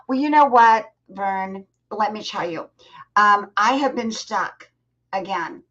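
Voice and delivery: usual speaking voice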